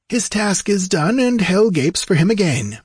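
high, lilting and blithe; airy, singsong pleasantness, pitched well up; pleasant remark. A narrator's reading. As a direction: toss it off cheerily, untroubled. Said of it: "remarked Peleus pleasantly".

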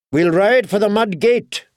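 a commander's call to ride; shouted command. A narrator's reading. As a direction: give the troop an order over the din. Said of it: "he commanded".

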